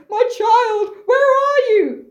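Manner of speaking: high, hammed-up cry of anguish; anguished calling. Blind Oedipus seeking his daughter, high anguished call. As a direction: call out searchingly, pitched high.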